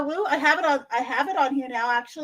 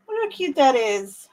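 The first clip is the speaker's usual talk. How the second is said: ordinary delivery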